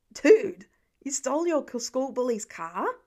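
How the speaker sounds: a sharp loud Dude, then slowing in disbelief; shocked disbelief. A narrator's reading. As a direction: call out in shock at what he just heard. Cooper shocked by Pete's confession.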